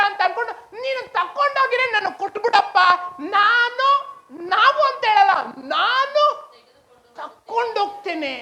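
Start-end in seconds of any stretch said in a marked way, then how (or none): 0.5-4.8 high, forceful demand; acting a woman speaking up as boldly as a man. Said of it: "ಒಬ್ಬ ಗಂಡಸು ಮಾತಾಡೋ ತರ". 5.6-8.3 high, emphatic "ನಾನು", then a pause; forceful, high-pitched vow; bold declaration. Acted. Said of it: "ಒಬ್ಬ ಗಂಡಸು ಮಾತಾಡೋ ತರ".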